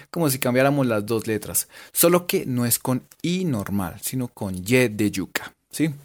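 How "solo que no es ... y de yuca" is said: ordinary talk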